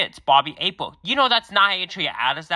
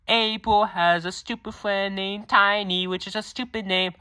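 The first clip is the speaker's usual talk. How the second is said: slow sneering taunt; 5 semitones higher than usual. Acted. Joker insults April's friend.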